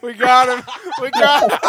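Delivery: loud triumphant callout; excited shout. Real, not acted. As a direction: yell it out to the squad. Game callout.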